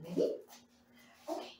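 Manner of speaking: ordinary talk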